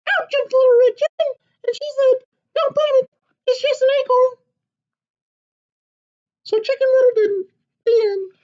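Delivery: high, loud, eager chick's squeak; a tiny excited hen butting in; little chicken voice. Acted. Chicken bursting into papa's story, high and eager.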